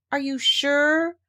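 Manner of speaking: slow, worried little question; hesitant and anxious, drawn out; anxiously. Acted. Piglet doubting the song must be sung outdoors, slow and worried. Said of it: "asked Piglet anxiously".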